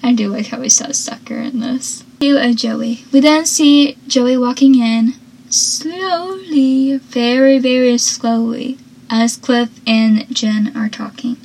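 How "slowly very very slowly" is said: stretched-out, drawling slow words; playfully dragged out; exaggerated slowness. Shown as a demonstration. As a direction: draw each word out for effect. Acting out Joey's slow walk.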